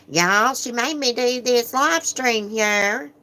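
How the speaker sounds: high, slow mocking imitation; mimicking his squealing talk. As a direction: squeal it out like him, drawn-out. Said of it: "That's the way he talks".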